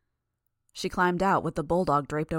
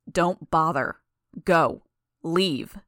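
loud, curt orders; angry dismissal; 6 dB louder, much slower than usual. A narrator's reading. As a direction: order them away in short, forceful words. Hostile dismissal in acted quarrel.

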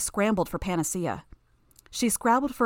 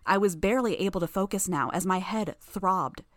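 ordinary delivery, nothing marked

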